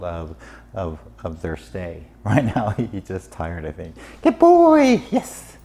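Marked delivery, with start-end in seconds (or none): 4.2-5.6 dog praise. Real, not acted. Trainer praising a tired Mickey, high and loud.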